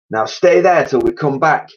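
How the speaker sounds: high, loud stern command; ordering. A narrator's reading. Mister Twit ordering the monkeys before leaving. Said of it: "Mister Twit ordered".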